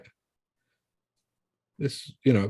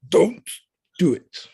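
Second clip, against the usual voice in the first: put-on kid threatening; 6 semitones higher, slower than usual. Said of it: "he's like".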